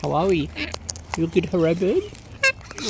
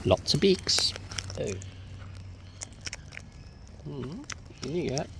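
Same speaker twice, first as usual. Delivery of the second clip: hushed whisper to herself; 4 semitones lower, 9 dB quieter than usual. Real, not acted. Watching the birds, she whispers in a hush. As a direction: breathe it out without voice.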